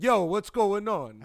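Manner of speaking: loud, high, slow host yell; intro voice. Shown as a demonstration. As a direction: yell the greeting like a show opener. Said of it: "I'm using my intro voice ... yelling at you".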